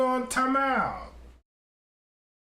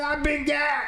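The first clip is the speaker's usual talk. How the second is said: shout; 6 semitones higher than usual. Retold or imitated. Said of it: "I said".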